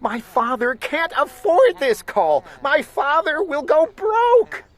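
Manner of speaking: high, rising distress; panicked. A narrator's reading. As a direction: plead in growing alarm. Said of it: "my little sister screamed".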